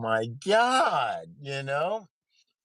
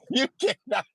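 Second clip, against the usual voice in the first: put-on Indian accent; 10 semitones higher, 4 dB louder than usual. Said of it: "do an Indian accent".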